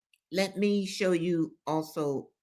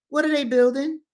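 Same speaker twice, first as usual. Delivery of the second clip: nosy officer; 7 semitones higher, 4 dB louder, faster than usual. Retold or imitated. Nosy officer questions narrator.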